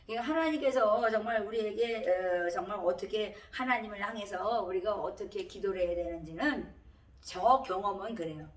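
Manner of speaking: normal talking voice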